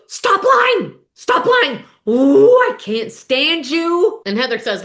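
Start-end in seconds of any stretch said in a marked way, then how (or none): 0.1-1.8 high, loud mock scream; imitated screaming fit. Shannon screaming at Katie across the dinner table, high mock shriek. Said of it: "Shannon loses her shit". 2.1-4.2 slow, loud disgusted snarl; mock furious. Shannon seething at Katie, slow loud mock disgust. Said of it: "Shannon loses her shit".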